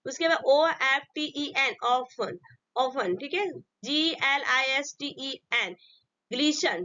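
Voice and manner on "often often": normal talking voice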